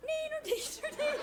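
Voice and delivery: high, teasing playground taunt; high sing-song teasing; mocking neener taunt. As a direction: tease in a put-on high little voice. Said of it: "one that goes".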